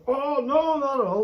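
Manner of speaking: high, loud imitated refusal; mock protest. Imitating mate still eating chilli, mock protest. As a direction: protest loudly, pitched right up. Mimics Liam refusing to give up.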